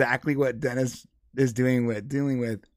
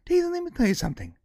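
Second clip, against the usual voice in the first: imitating Dennis; 4 semitones lower, 6 dB quieter than usual. Dennis lecturing operator.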